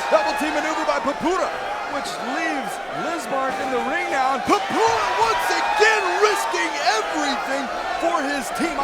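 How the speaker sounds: high, loud, racing call; excited play-by-play. Real, not acted. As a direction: call the big spot at full pitch. Fast high call of the action.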